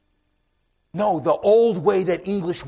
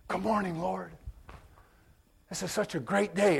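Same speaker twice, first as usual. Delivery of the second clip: softer, gentle morning greeting to God; quiet morning prayer; 8 dB quieter than usual. Retold or imitated. Preacher greeting the Lord at dawn, soft and tender. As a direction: hush it like an early morning prayer. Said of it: "she's like who are you talking to".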